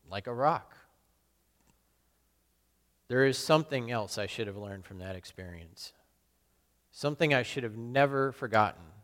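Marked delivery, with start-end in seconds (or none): none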